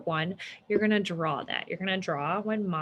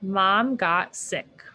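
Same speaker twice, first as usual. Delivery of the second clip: very slow, word by word; slow blending; 3 dB louder, much slower than usual. Shown as a demonstration. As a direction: read it back slowly. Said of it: "Sounds like a K".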